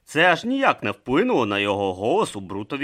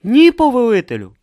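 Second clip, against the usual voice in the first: meek reply; 13 dB louder than usual. Acted. Brutha answers his superior.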